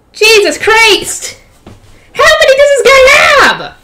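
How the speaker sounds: high, slow outraged disbelief; exasperated shout. Real, not acted. Reader exasperated at many doubles.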